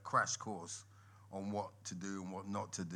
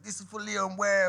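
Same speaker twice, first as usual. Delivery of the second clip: mock stage announcement; 12 semitones higher, 11 dB louder than usual.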